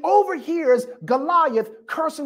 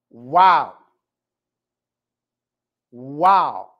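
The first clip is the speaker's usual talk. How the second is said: low, drawn-out wow in wonder; awed wow; 8 semitones lower, 4 dB louder than usual. Real, not acted. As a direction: let the wonder sit in it. Awed reaction to scripture.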